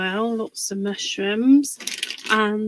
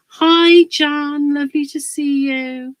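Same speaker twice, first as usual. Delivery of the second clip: higher and louder welcome; bright hello; 5 semitones higher, 8 dB louder than usual. Real, not acted. Greeting a viewer.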